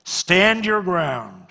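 loud, high solemn Bible reading; scripture proclaimed. Acted. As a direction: proclaim the passage to the congregation.

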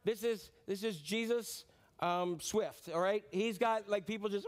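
ordinary talk

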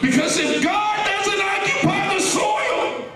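very high sustained sermon shout; full-force preaching. Real, not acted. Preacher warning congregation of weeds in the soil, full-force preaching. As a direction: hammer out a warning in repeated waves, high-pitched.

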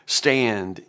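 ordinary talk

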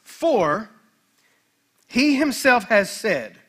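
slow, deliberate Bible reading with long pauses; scripture reading. A narrator's reading. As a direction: read each phrase out to the congregation, weighty and measured. Reads scripture aloud to congregation.

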